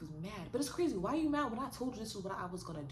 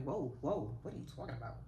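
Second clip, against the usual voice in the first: startled alarm; 6 semitones lower than usual. Retold or imitated. To her jealous ex, a low stunned whoa. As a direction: back away from a wild threat. Reacting to his kill threat.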